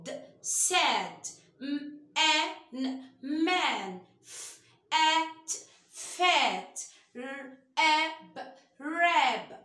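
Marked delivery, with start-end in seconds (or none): 0.0-4.1 slow letter-sound blending into ساد and مان. Shown as a demonstration. Teacher to kids, slow letter-by-letter sounding out. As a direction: sound out each letter slowly then blend. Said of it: "اقول له س ا".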